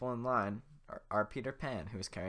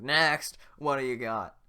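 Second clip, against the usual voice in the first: high, loud call; shouting guard; 5 semitones higher, 14 dB louder, slower than usual. Acted. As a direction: call the next one up. Guard calls again.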